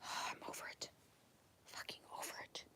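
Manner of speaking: sighing, breathy whisper; drained, barely voiced; exhausted whisper. Real, not acted. After shouting at the guardians, a spent whisper. Fed-up sigh after rant.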